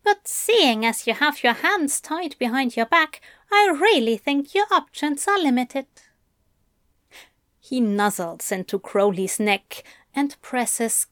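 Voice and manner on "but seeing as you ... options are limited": higher, brisk teasing voice; Aziraphale. Acted. Angel to his bound demon, playful taunt. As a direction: taunt him playfully, voice lifted.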